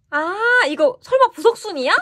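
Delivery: high, loud gasp of disbelief on 설마; can't believe what she's seeing; excited surprise. Real, not acted. Fan spotting the BSS card, excitedly surprised.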